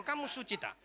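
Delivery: high-pitched challenging question; pointed retort. Retold or imitated. Pastor answers the minister who rebuked him, pointed retort.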